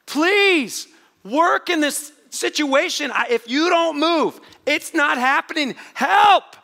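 high, loud drawn-out pleading cry; desperate supplication. Acted. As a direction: shout a desperate prayer for help. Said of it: "Do you know what supplicated is".

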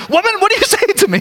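high, fast mock protest with a laugh; a grown son fed up with his mom; exasperated son. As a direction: laugh through a hammed-up son's complaint. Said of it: "it translates as".

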